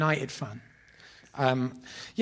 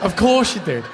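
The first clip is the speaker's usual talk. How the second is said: higher, louder teasing "Of course you did"; mock jeer; 6 semitones higher, 9 dB louder than usual. Mate jeering at a name-dropper, loud and teasing. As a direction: rib him loudly, course stretched high.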